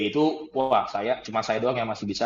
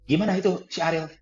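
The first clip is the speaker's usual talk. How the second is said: higher, altered voice on the phone; changed voice; 7 semitones higher than usual. Retold or imitated. Branch head asks after Ariel, voice changed and higher. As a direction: imitate a caller whose voice has turned strange. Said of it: "Suaranya udah mulai beda".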